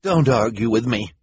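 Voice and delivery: loud, sharp snap; snapping. A narrator's reading. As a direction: cut him off sharply. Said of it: "Esme snapped".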